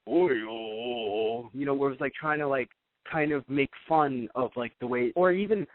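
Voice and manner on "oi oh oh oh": low, very slow, drawn-out chant; imitating the Japanese dub, lower and very slow; Japanese shaman imitation. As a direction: groan it out like an over-the-top shaman. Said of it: "the Japanese version was like".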